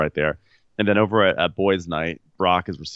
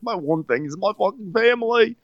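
mock crying Brock. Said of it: "he starts crying".

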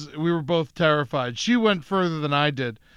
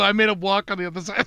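ordinary talk

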